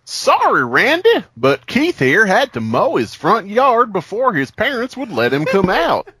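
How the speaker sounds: put-on high, apologetic teen voice; sheepish. Troy apologizing to Randy, high and sheepish. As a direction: squeak out a sheepish excuse. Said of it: "a sheepish tone in his voice".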